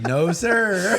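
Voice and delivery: high, loud mock "no, sir"; mock refusal. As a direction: imitate a refusal, pitched right up. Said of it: "He's like".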